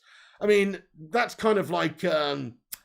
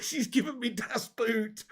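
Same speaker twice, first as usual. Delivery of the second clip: put-on slow, high German-accented das Boot; comic German manager line, slow and high; German accent catchphrase; 5 semitones higher, 4 dB quieter, slower than usual. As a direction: savour it in a mock German voice, slowly. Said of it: "repeating the Farke line".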